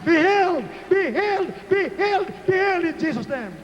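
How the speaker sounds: high, rallying healing chant; full-force preaching. Real, not acted. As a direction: call out praise to lead a crowd. Leads congregation in healing chant.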